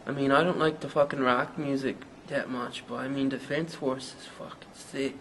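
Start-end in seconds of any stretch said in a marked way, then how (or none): none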